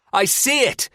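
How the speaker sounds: high, loud shout. A narrator's reading. Terry spots it from the rocking boat, shouting. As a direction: cry it out at the top of the voice. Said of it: "Terry shouts".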